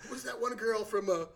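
usual speaking voice

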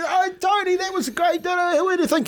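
way up high, chirpy mock interviewer; mic interviewer. Player imitating the rodeo interviewer chirping after his fall. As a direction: play the cheerful guy with the mic. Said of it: "walked out with a mic and goes".

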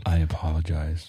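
drain all the fun out of it; jocularity turned down. Shown as a demonstration. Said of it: "Turning the jocularity knob down".

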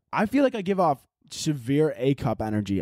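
ordinary talk

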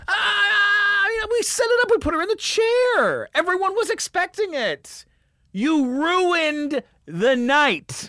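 very high, loud mock wail; hammed-up screeching accusation; hysterically outraged woman. Lady wails at him, retold.

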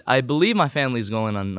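normal talking voice

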